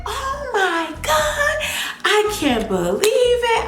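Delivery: high-pitched, drawn-out mock yelling; re-enacted shriek at big news; retold excited yell. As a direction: yell it high, stretching the words in disbelief. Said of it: "yelling, like".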